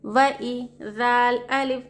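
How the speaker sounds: slow, drawn-out sing-song letter spelling; slow spelling drill. Shown as a demonstration. Teacher spelling out Quranic words for students, slow sing-song chant. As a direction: chant each letter and vowel, then the joined syllables.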